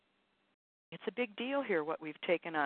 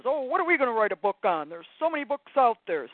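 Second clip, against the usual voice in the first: loud, higher, hurried naysayer voice; Serious Sam; 4 semitones higher, 8 dB louder than usual. Acted. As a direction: play the objecting ego, fast and louder. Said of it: "Serious Sam says".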